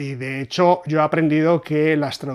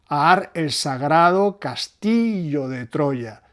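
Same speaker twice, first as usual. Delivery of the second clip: "ar el sagrado castillo de Troya" slow, the stresses on "-gra-" and "-ti-" pressed; scanning the rhythm; much slower than usual. Shown as a demonstration. Teacher slowly scanning a verse line to show the same pattern. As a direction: tap out the beat of the line. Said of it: "Usa el mismo esquema".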